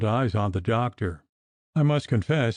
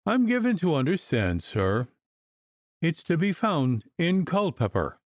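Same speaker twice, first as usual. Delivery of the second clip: offended reply; 6 semitones higher than usual. A narrator's reading.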